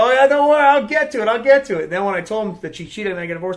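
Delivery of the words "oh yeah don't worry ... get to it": loud, high answer to a heckler; hammed-up hurried reassurance; retold fending-off. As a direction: call back over the crowd, putting them off.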